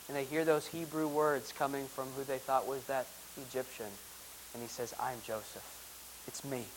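softly awed narration of the reveal; hushed reading. Acted. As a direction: draw them in with a near-hush.

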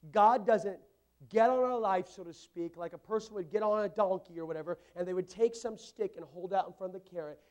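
loud, high, driving sermon delivery; preaching at full force. Acted. Pastor urging the church to pray boldly, preaching full force. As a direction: pound it out to the whole congregation.

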